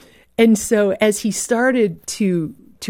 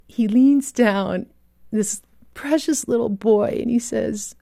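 ordinary talk

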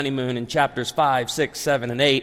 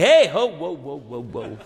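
alarmed backpedalling. Retold or imitated. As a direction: act out halting someone's mistaken assumption. Halts a mistaken customer, acted.

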